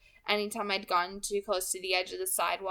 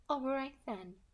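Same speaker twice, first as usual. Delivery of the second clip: light, higher, smooth villain voice; pleasant soft alto; 6 semitones higher, 5 dB quieter than usual. Acted. Kidnapper calmly threatening the girl on the phone, pleasant and light. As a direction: menace wrapped in a pleasant voice. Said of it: "the agreeable voice continued".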